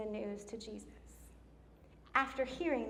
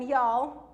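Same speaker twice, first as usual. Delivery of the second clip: Y'ALL called out louder; loud, emphatic "Y'all"; emphatic address; 8 dB louder than usual. Retold or imitated. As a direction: punch "Y'all" to the whole group. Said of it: "he emphasizes it".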